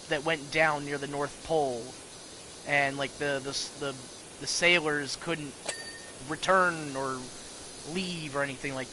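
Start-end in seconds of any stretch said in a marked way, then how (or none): none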